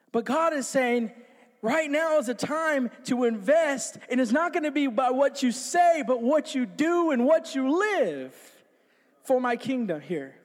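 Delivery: normal talking voice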